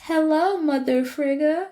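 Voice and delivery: butter-wouldn't-melt hello; sing-song greeting. Retold or imitated. Asgardians greet Frigg sweetly.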